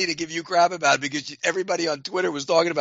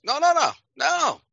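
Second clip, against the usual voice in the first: put-on insistent old linebacker; 9 semitones higher than usual. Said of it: "And he goes".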